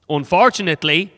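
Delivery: ordinary delivery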